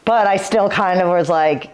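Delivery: ordinary talk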